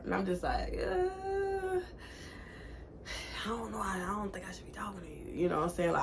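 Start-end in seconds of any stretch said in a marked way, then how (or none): 0.8-5.4 hesitant whisper. Retold or imitated. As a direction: back away in a hushed voice. Retold hesitant refusal.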